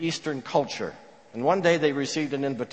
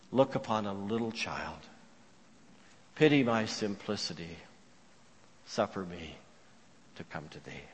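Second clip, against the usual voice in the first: hushed simple prayer; 7 semitones lower, 9 dB quieter than usual. Acted. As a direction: pray it quietly, phrase by phrase, sinking lower. Professor's overheard childlike bedtime prayer.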